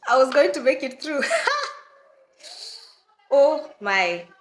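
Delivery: laughs through the words; chuckling at her own naivety; laughing. Real, not acted. Laughing at herself as she tells viewers.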